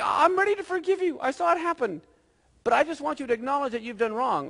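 high-pitched pleading parent; acted-out parent ready to forgive; exasperated parent. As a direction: plead earnestly, pitched well up. Parent voicing plea to child.

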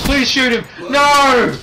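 high urgent plea; panicked pleading. Real, not acted. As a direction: beg in a rush. Pleading during firefight.

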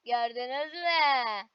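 ordinary talk

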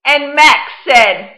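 story reading. Acted. Teacher reading Where the Wild Things Are to the class, storytime voice. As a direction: read it like storytime, letting big moments swell. Teacher reads picture book aloud.

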